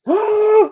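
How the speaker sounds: high surprised gasp; sharp gasp. Real, not acted. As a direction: catch your breath in shock. Forgot damage, sudden realization.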